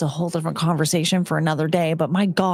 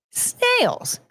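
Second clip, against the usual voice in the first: snails shrieked very high in disgust; disgusted squeal. Real, not acted. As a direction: squeak the word out in revulsion. Mocking snail eating.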